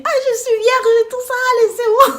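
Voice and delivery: very high, loud mock bawling; wailing plea. Pleading with everyone to be left alone, wailing. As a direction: wail it like a hammed-up victim. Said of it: "je commençais à parler comme ça".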